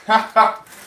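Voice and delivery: high loud burst of laughter. Real, not acted. Streamer at own plumber joke, loud laugh.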